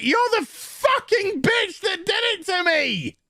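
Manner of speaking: high hammed-up angry yell; mock furious yell. Patient screaming at his doctor, high mock fury.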